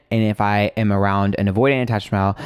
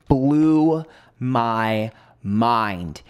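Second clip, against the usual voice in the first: very slow, each word stretched out; awestruck emphasis; much slower than usual. Real, not acted. Telling viewers about the therapist's insight, awestruck and drawn out. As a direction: draw every word out for impact.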